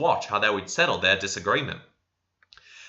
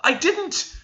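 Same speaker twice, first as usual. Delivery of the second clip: very high denial; cried denial. A narrator's reading. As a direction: cry out the denial. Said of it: "she cried".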